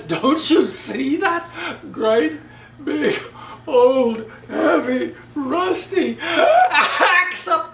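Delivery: high, drawn-out wail, laughing as it climbs; slow, high mock-tragic lament with laughter; fretful silly mother. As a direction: build the mother's dread until it tips into giggles. Silly mother repeats the fear.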